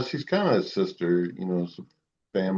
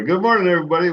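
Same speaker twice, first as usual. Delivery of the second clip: announcer greeting; 10 semitones higher, 6 dB louder, much faster than usual. Acted. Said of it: "Let's start over".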